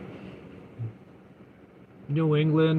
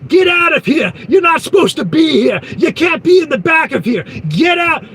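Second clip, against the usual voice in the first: much higher, loud repeated hammed-up shouting; mock yelling; 9 semitones higher, 6 dB louder than usual. Angry man chasing the trucker out of the warehouse, high mock shout. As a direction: bellow it over and over like a maniac. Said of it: "He's yelling".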